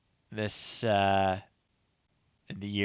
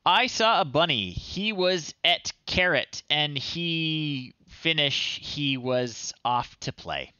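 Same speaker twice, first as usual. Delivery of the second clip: put-on high, slow storybook voice, pitched up for children; reading a child's story aloud; 5 semitones higher, slower than usual. Streamer reads a child's old story aloud in a high storybook voice. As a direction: read it like a bedtime tale for little kids.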